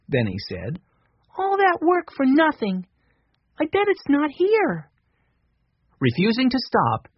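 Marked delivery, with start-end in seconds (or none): 1.4-4.9 put-on high, deflated boy's voice; a small boy giving up hope; disappointed little boy. A narrator's reading. Narrator voicing young Benny, let down.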